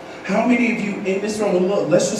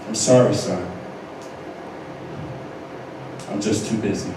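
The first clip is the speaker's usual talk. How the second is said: much lower, quiet, cold father voice; lowered voice of a busy dad brushing off his son; imitating dad; 12 semitones lower, 10 dB quieter than usual. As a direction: drop low and flat as the distant dad. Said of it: "My dad said to me".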